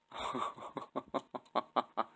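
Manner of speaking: long, giddy run of chuckles; giddy laugh. Real, not acted. Builder thrilled at a big moment, giddy laugh. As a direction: let out a drawn-out delighted laugh. Giddy over wheels going on.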